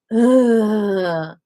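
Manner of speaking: loud fed-up groan from the queue; a put-upon ugh; annoyed groan. Retold or imitated. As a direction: groan like a bored shopper. Voicing annoyed people in line.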